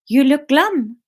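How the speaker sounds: put-on stagey sitcom French accent; 'Allo 'Allo French. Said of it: "It's very allo, allo French".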